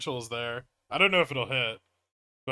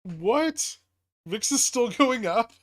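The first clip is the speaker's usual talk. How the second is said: shocked; 9 semitones higher, 7 dB louder, slower than usual. Real, not acted. Market suddenly moves.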